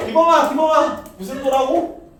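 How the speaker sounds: loud, high call to a student. Real, not acted. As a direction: call his name across the room. Teacher calls out to student. (학생 이름을 크게 부르기)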